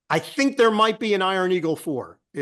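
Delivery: ordinary delivery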